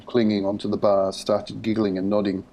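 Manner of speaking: usual speaking voice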